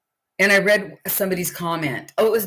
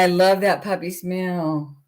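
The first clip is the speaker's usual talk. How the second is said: ordinary talk, nothing marked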